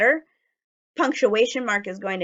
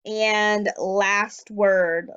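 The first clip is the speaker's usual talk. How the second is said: slow announcement; much slower than usual. Shown as a demonstration. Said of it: "announces word slowly".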